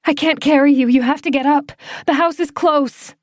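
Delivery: high, loud desperate urging; urgent pleading shout. Acted. Claire urging frozen Doran toward the house, loud high plea. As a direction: push him up with your voice.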